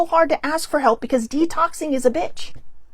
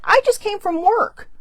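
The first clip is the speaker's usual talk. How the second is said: high, pitched-up indignant protest; exasperated retort; 8 semitones higher than usual. Retold or imitated. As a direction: throw it back at him, incredulous. Retort to doctor's dismissive suggestion.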